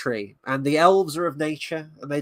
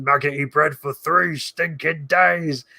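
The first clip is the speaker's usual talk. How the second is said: complaining orc growing louder; 4 dB louder, slower than usual. Acted. Orc griping about the rations, put-on orc voice. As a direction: grumble out the line as an orc. Quotes film orc's complaint, then repeats plainly.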